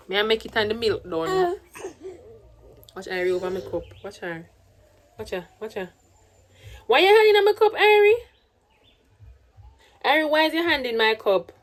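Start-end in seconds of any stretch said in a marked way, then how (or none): 2.9-5.9 hushed watching. Real, not acted. Watching baby grab cereal. 6.9-8.1 sharp scolding call. Real, not acted. Scolding baby's hand in cup.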